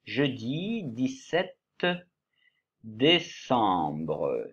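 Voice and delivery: dictating each word slowly; slow dictation. Shown as a demonstration. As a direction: spell it out slowly for the class. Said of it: "en écriture cursive". (dictée lente)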